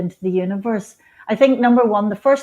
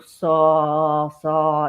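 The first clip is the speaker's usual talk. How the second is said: a deeper, grounding voice; lowered voice; 3 semitones lower than usual. Shown as a demonstration. Showing the listener how she centres herself, voice lowered. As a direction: drop the voice down to centre yourself. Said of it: "I'll lower my voice like this".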